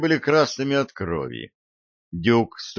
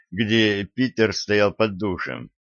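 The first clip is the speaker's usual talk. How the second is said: ordinary delivery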